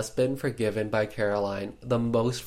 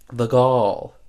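loud, disbelieving outburst; outraged exclamation; 9 dB louder than usual. Real, not acted. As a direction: blurt it out, scandalised. Narrator outraged at Barb.

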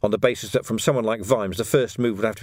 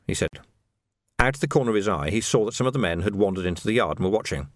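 usual speaking voice

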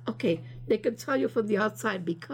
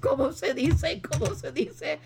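laughing; 5 semitones higher than usual. Retold or imitated. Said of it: "running around the island saying".

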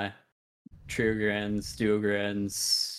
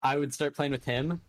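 ordinary talk, nothing marked

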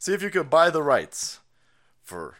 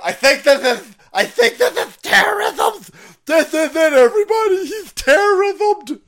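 much higher, loud, hammed-up flustered agent voice; mocking FBI agent; 13 semitones higher, 10 dB louder than usual. Streamer mocking an FBI agent, high loud squeal. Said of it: "a very rainbow F B I agent is sitting there going".

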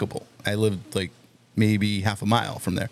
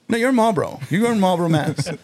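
cop voice; 8 semitones higher, 3 dB louder, faster than usual. Retold or imitated. Cop correcting him.

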